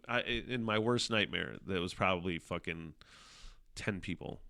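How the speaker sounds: usual speaking voice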